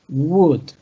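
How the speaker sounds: "would" said as wud, the L dropped; would without L. Shown as a demonstration. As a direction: say "would" with a silent L. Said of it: "to omit the L".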